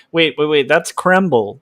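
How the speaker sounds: ordinary delivery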